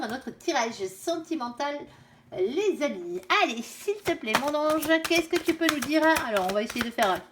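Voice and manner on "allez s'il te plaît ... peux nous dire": raised, sing-song invocation; asking the cards' angel for guidance; plea to her angel. Real, not acted. Invokes her angel again.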